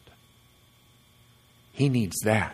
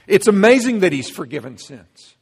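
amazed exclamation; 6 semitones higher, 10 dB louder than usual. Real, not acted. Preacher's climax.

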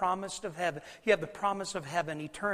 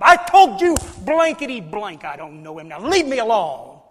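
acted angry denial; 3 semitones higher, 14 dB louder than usual. Peter denying Jesus a third time, mock angry shout. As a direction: blow up at the questioner, hammed-up.

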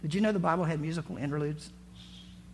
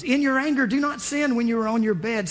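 louder and higher, charged exhortation; worked-up exhortation; 5 semitones higher, 4 dB louder than usual. Retold or imitated. David scolding the others in his prayer, worked-up loud exhortation. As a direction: preach it with rising heat.

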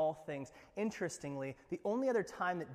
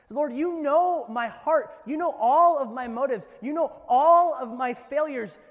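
Peter pleading; 9 semitones higher, 15 dB louder than usual. Acted. Preacher as Peter pleading to Jesus, loud and impassioned. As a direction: cry out Peter's confession.

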